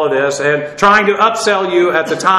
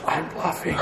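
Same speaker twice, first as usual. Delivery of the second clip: whispered confession; 2 semitones lower, 13 dB quieter than usual. Retold or imitated. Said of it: "he looks down and goes".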